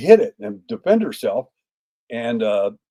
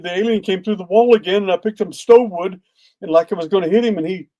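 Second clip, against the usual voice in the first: high retold tearful account; imitated crying; 7 semitones higher than usual. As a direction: mimic a tearful woman, pitched up. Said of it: "she was crying, saying all this".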